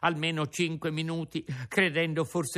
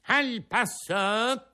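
slow, drawn-out ringmaster call, higher; shouted command; 7 semitones higher, 3 dB louder, much slower than usual. Acted. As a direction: call it out across the ring. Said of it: "non gli gridò".

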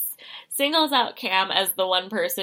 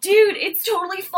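mock strained voice; 10 semitones higher than usual. Voicing the sick man.

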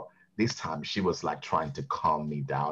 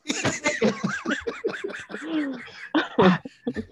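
laughing. Real, not acted. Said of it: "laughing at own Karen impression".

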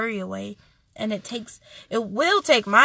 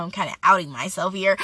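ordinary talk

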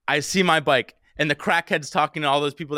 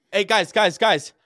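urgent call for attention, higher and louder; 7 semitones higher, 6 dB louder, slower than usual. Retold or imitated. Bike theft victim hailing cops in the park, calling out. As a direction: flag them down with quick repeated calls.

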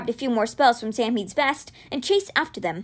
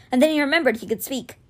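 ordinary delivery

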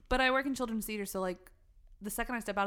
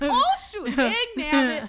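mock outburst; 5 semitones higher, 13 dB louder than usual. Mocking friend's mild curse.

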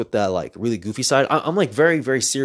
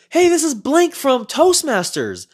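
much higher, louder and slower fake-bright woman's voice; forced cheerful woman; 5 dB louder, slower than usual. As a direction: sound like a smile pasted on. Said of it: "she forced a smile".

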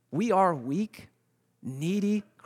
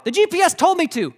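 high, loud defensive wail; mock a panicked, self-justifying caller; freaking out; 13 semitones higher, 8 dB louder than usual. Lost wife blames the GPS to her husband, freaking out. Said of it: "She was freaking out".

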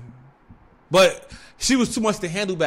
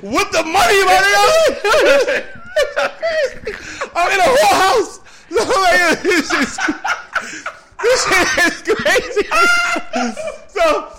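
high, loud words breaking into laughter; real hysterical laughter; 13 semitones higher, 7 dB louder than usual. Real, not acted. Storyteller cracking up over money and condoms on the floor, hysterical. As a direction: lose it completely while trying to finish.

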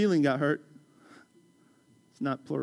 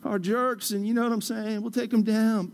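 higher-pitched, gushing buddy on the phone; imagined supportive friend; 5 semitones higher than usual. Retold or imitated. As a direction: imitate a pal who totally takes your side. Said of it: "bear hug me through the phone and be like".

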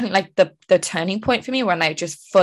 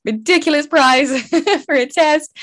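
laughing; 8 semitones higher, 4 dB louder than usual. Retold or imitated. Retelling paying for a test.

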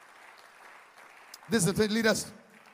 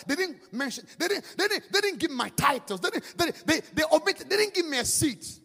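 high, stammering hurt pride; mock offended dignitary; 8 semitones higher, 5 dB louder than usual. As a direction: whine like a slighted VIP. Offended dignitary complaining about titles.

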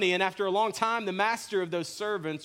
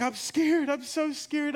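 high-pitched mock-frightened kid voice; scared child; 10 semitones higher, slower than usual. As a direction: imitate a little boy who is scared.

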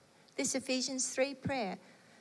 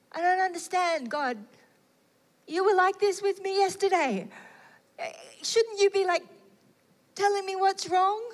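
higher, louder confused questioning; a heart puzzled by being loved; bewildered plea; 6 semitones higher, 4 dB louder than usual. Retold or imitated. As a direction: plead in disbelief with God.

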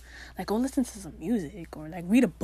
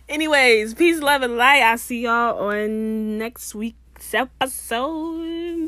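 sign-off voice; 3 semitones higher, 9 dB louder, much slower than usual. Acted. Loud slow sign-off.

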